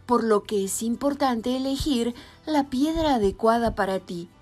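normal talking voice

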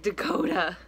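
loud, eager calling of a friend's name; excited shout to a friend on the phone. Retold or imitated. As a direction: call your friend over with big news. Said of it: "I was like".

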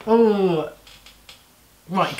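ordinary delivery

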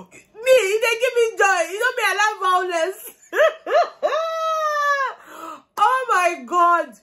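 high-pitched, delighted laughing squeal; gleeful high giggling. Real, not acted. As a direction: crack up as you talk, voice shooting up high. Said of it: "Better stop laughing now".

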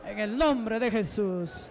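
slow, drawn-out holy words; solemn praise. Real, not acted. Preacher closing a prayer in Jesus' name, slow and solemn. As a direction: stretch each word out to close the prayer.

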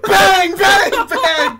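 loud mock gunshots, high and punchy; bang bang sounds. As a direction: shout each bang like a shot. Imitating gunshots at the screen.

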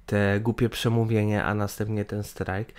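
usual speaking voice

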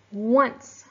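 "once" said loud with a rise; stressed syllable. Shown as a demonstration. Teacher isolating the stressed word "once". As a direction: punch the single word "once". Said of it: "this word is stressed".

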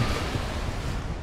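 breathy villain laugh; evil giggle. Real, not acted. Laughing at the trapped opponent, evil giggle. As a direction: cackle under the breath.

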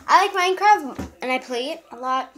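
ordinary talk

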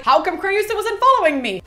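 high, loud mock cluelessness; mock bewilderment. Teller mocks Aeneas asking about his wife, mock-bewildered. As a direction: mimic a clueless husband's complaint.

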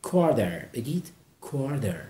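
ordinary talk, nothing marked

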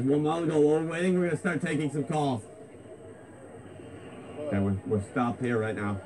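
ordinary delivery

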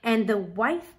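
usual speaking voice

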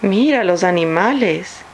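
ordinary talk

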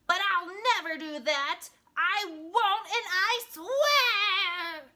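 loud, high storybook tantrum; flipped-out tantrum. A narrator's reading. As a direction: selfish pug throwing a fit, building to a drawn-out yell. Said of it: "Pig flipped his wig".

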